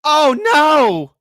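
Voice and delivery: high, loud mock gasp of dismay. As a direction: fake a horrified oh no. Mocking fake dismay.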